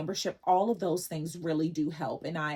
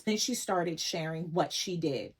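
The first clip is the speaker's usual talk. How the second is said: ordinary talk, nothing marked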